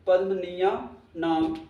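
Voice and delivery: slow, syllable by syllable; slow dictation. Shown as a demonstration. Teacher dictating the sutra word to students, slowly. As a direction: spell out उपपद्मनीयानाम slowly so students can write it. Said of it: "teacher repeats sutra word slowly".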